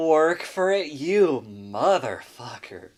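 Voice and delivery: loud, drawn-out mock yell. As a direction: bellow it at the screen, half joking. Yelling at slow tutorial.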